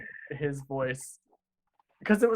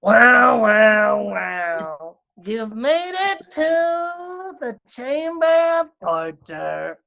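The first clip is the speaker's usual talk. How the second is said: eerie high-pitched alien character, very slow; alien torturer voice; 8 semitones higher, 4 dB louder, slower than usual. Acted. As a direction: stretch every word like a relishing alien torture expert. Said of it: "he's like this".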